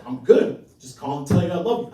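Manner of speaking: loud, awkward declaration of love; half-yell affection down the phone. Acted. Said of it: "kind of yelling, half yelling".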